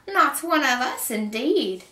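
high, loud, slow indignant echo; scornful screeching witch; witch's shriek. A narrator's reading. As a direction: throw the insult back as an outraged witch. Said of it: "the witch shrieked at Kate".

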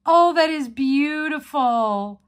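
real thrilled gush; excited awe. Real, not acted. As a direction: swoon over the pen. Gasping over the gift.

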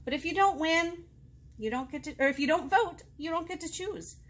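usual speaking voice

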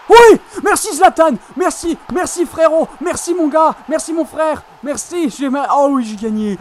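loud high victory shouting; ecstatic celebration. Real, not acted. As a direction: celebrate the goal at full voice. Celebrating a winning goal.

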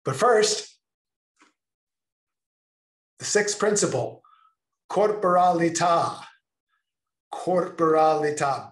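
loud, high grand announcement; announcing. Real, not acted. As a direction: proclaim it with flourish. Dramatic announcement.

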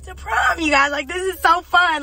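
high, loud burst of real excitement; excited. Real, not acted. Telling viewers about the prom send-off, excited. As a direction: gush loudly, pitched well up.

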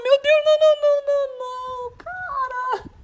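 high, pleading no no no; dismayed cry. Real, not acted. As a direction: watch the goal go in helplessly. Conceding goal, despair. (desespero ao sofrer gol)

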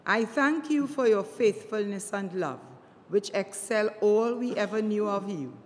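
ordinary talk, nothing marked

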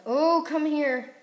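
high, loud, drawn-out call; stretched, excited high call; eager chase cry. Real, not acted. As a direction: call out slowly and loudly to your prey. Chasing players in the game.

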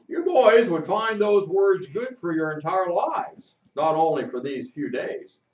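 the captain promising a search tomorrow; kindly captain, planning. Acted. Captain voiced by the reader.

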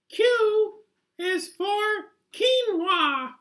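very high, silly little puppet voice reading the alphabet slowly; a tiny cartoon critter sounding out letters; squeaky puppet voice. Puppet hosting its alphabet show, very high squeaky voice.

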